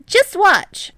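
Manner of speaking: high, loud urging. A narrator's reading. Hinata urging Yamamoto on court, high loud urge. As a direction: push him to watch, loud and high. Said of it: "Hinata urges".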